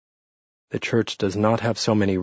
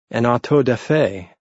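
usual speaking voice

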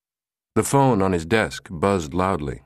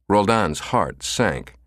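ordinary delivery, nothing marked

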